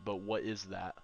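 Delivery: ordinary talk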